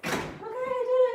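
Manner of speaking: high, loud relieved squeal; triumphant squeal. Real, not acted. Released bee, squealing.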